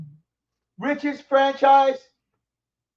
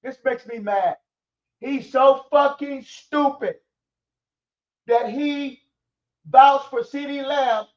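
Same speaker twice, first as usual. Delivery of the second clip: ordinary delivery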